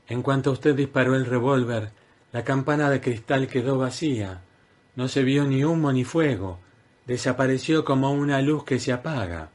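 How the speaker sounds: lightly voiced woman explaining; Lily's voice. A narrator's reading. Lily voiced higher.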